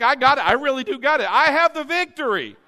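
loud emphatic claiming; with conviction. Shown as a demonstration. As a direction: say it like you own it. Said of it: "like you mean it".

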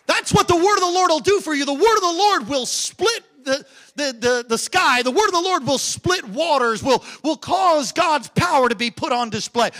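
loud, fast, rousing sermon build; fiery preacher declaring the word; full-force preaching. Acted. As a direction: hammer it home to the congregation. Preaching climax on God's word.

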